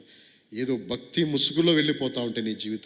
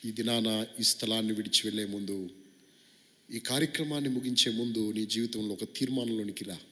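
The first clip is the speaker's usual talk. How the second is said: ordinary delivery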